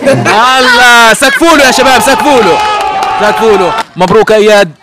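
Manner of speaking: loud, high cheering call to the crowd; rally the crowd to clap, booming; booming prize announcer. Real, not acted. Host cheers prize winner.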